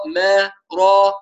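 ما thin then را heavy, slowly; ما light, را full, each held; separate syllables. Shown as a demonstration. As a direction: drill ما, then را, slowly and apart. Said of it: "هكذا يكون التدريب".

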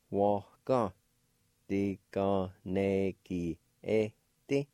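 "Waka. Diganegi eti." drawn out slowly; slow demo. Shown as a demonstration. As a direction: say it slowly so a learner can follow. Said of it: "like this".

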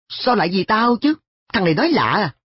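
high, startled outcry; exclaiming. A narrator's reading. Boy cries out, startled that An studies for his sake. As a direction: cry out in disbelief. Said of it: "tôi kêu lên".

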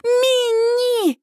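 high, drawn-out wail of the name; panicked high-pitched call; frantic scream. A narrator's reading. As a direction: cry out in desperation for your child. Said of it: "истошно ору я".

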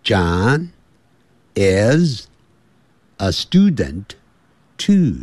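slow American-accented model sentence; teacher's American accent, word by word with pauses; American accent for learners to repeat; American English. Shown as a demonstration. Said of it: "我们现在学的是美式".

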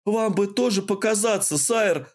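высоко и взволнованно; тревожно уговаривай командира; с тревогой. A narrator's reading. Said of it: "С тревогой ... произнёс Дион". (тревожно уговаривает командира)

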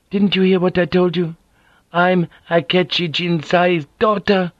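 a dignified woman, hurt and firm; proud wounded noblewoman. Acted. Mariko, anguished.

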